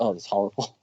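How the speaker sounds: usual speaking voice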